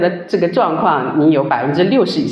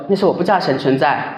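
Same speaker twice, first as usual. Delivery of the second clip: ordinary delivery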